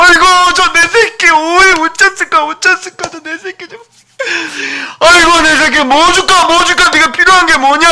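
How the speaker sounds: father overwhelmed by his son's words, weeping; overcome with emotion. Acted. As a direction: cry out in moved delight. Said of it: "너무 놀라가지고 감격을 해가지고".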